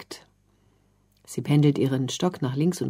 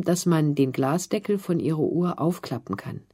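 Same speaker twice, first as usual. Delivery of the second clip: usual speaking voice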